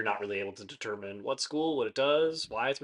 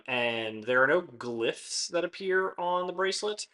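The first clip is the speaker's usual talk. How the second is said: normal talking voice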